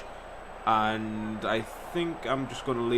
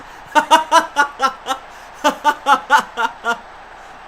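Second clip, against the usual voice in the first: high delighted laugh; burst of laughter; 13 semitones higher, 9 dB louder than usual. Real, not acted. Laughing at his own goal, delighted.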